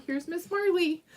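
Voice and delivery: usual speaking voice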